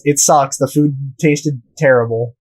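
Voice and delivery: ordinary delivery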